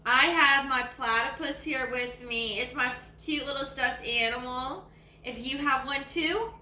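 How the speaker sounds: usual speaking voice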